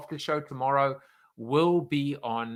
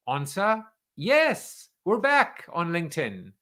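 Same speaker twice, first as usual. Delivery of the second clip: excited; 7 semitones higher, 7 dB louder than usual. Real, not acted. Host to LinkedIn viewers when the stream returns, delighted cheer. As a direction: pitch jumps high and loud, delighted. Stream comes back live.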